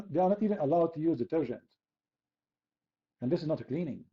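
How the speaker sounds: ordinary talk, nothing marked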